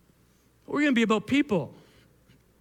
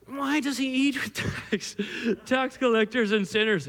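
Pharisee voice. Acted. Pharisees questioning the disciples, mock Pharisee voice. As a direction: ask it as a hammed-up Pharisee. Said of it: "I'm all done with that".

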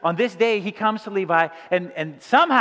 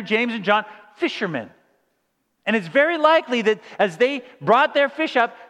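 ordinary delivery

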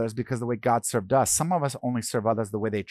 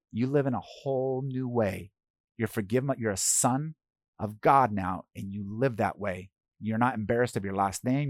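tender reassurance; 5 dB quieter than usual. Retold or imitated. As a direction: speak softly to comfort a forgiven man. Telling the man he's forgiven.

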